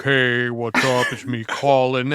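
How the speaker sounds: a lightly voiced slow, laid-back guy; a hint of a mellow casual friend; Colin's offstage voice. Mimicking a colleague's everyday voice, lightly and slowly. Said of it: "That's his normal speaking voice".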